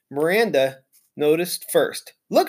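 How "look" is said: usual speaking voice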